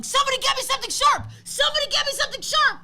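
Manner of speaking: high, loud, fast frantic yelling; retold frantic yell. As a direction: shout it like a kid demanding a weapon. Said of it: "I'm yelling".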